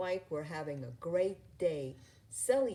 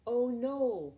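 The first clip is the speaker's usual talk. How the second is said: dismayed cry; 7 semitones higher than usual. A narrator's reading. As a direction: panic as you remember. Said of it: "he cried".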